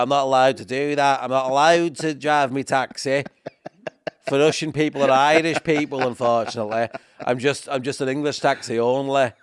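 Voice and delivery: hammed-up English cabbie accent; mock English accent. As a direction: mimic an officious English taxi driver. Mimics the local English taxi driver.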